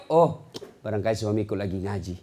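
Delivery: calm trusting wife. Acted.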